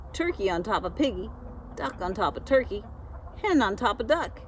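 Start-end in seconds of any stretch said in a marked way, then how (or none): none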